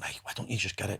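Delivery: very soft, low coaxing voice; soft, sultry. Acted. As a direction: murmur it like a tempting inner voice. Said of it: "It's in soft, sultry".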